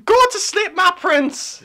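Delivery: Nina voice. Acted. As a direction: screech it like a crazed old hag. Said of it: "Do Nina voice".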